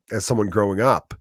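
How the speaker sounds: usual speaking voice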